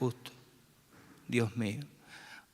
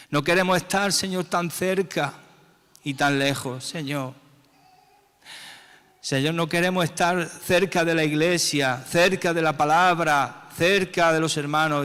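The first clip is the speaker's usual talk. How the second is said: higher and louder pleading, pressing on each cerca; impassioned plea; 6 semitones higher, 5 dB louder than usual. Real, not acted. Begging God not to be near but far, raised plea.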